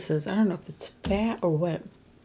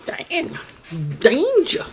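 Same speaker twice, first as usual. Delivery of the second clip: high loud mock alarm; playful shout; 6 semitones higher, 6 dB louder, much slower than usual. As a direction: yell it like a joke warning.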